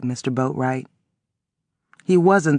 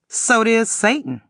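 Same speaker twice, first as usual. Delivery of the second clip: pointed retort; 7 semitones higher than usual. A narrator's reading. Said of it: "I reminded".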